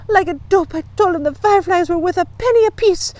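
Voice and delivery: put-on high, urgent young girl's voice; upset girl client. A narrator's reading. Fanchon tells Encyclopedia what happened, upset. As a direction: tell it high and indignant.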